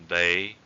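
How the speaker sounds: "Bei" sliding gently upward; rising tone. Shown as a demonstration. Teacher showing the word for go, rising tone on "Bei". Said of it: "raising tone".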